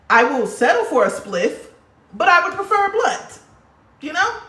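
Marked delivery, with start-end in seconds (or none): 2.1-4.4 much higher, teasing and coy; playful high voice. Real, not acted. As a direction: sing it up high like a cheeky confession. Playful preference for blunts.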